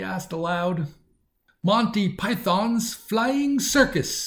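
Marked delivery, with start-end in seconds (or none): 1.6-4.3 put-on high, slow, sing-song English accent; lilting English accent, pitched well up and drawn out slowly; playful English accent, high and slow like a TV show opening. A narrator's reading. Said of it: "in the same strange sing-song and English accent".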